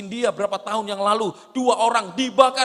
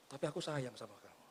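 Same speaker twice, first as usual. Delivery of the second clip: much softer, lower and quick; tender aside; 8 semitones lower, 19 dB quieter, faster than usual. Acted. Preacher showing love to the same brother: soft, low tender aside. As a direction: soften right down to reassure him. Loving follow-up after mock anger.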